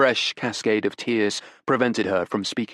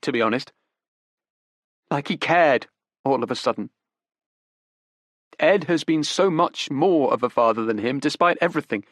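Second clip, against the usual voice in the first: put-on higher, urgent young woman pleading; desperate young woman; 6 semitones higher, 4 dB louder than usual. Daughter defends her father to detectives, desperate. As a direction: grasp for excuses, pitched up. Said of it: "she then asked desperately".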